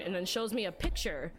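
usual speaking voice

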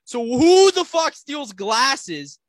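escalating mock-outraged rant, ending in a drawn-out yell; dad going off. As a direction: imitate a furious dad, stretching the loudest words. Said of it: "He, he starts going off".

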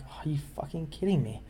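stunned disbelief. Retold or imitated. DM muttering after the fighter rolls ninety-nine, stunned. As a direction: say it to yourself, floored.

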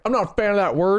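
light stern dad impression. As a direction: sound like a prim dad. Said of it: "You sound like a dad".

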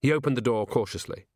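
ordinary delivery

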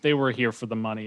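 ordinary talk

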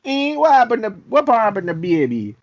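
playful Jamaican lilt; imitated Jamaican accent. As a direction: mimic the Jamaican lady on the record. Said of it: "her voice and that accent".

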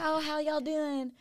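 high, drawn-out Southern twang. Retold or imitated. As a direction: playfully imitate a Southern US greeting. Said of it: "got a little Southern twang".

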